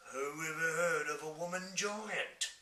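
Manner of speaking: loud, slow giant bellow; giant shouting. Acted. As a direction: boom it out like a giant. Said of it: "shouted the B F G".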